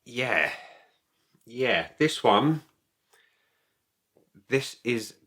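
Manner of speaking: normal talking voice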